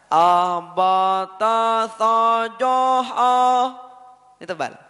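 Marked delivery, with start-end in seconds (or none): 0.1-3.7 abata sajaha chanted slowly with thick, full vowels; exaggerated thick letters; heavy letters demo. Shown as a demonstration. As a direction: show the wrong heavy reading of abata. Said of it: "Gak mungkin kita membaca".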